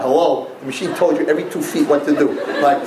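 usual speaking voice

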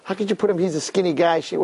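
plain reading voice

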